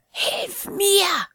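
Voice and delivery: put-on high, urgent old woman order; commanding old woman. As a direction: demand help like a fierce old crone. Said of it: "forderte die Alte".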